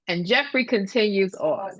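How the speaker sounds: ordinary talk, nothing marked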